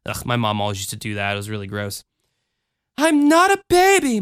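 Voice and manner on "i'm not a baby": put-on high, louder childish protest; small girl objecting to being babied. A narrator's reading. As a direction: insist like an offended little girl. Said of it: "Michelle protested".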